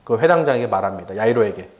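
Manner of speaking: ordinary talk, nothing marked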